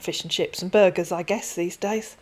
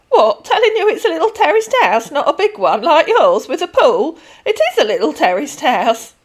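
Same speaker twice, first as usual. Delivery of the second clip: much higher, louder affronted pensioner; indignant old lady; 8 semitones higher, 10 dB louder than usual. Acted. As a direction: push back, offended. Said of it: "with a manner I don't like".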